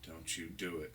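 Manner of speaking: ordinary talk, nothing marked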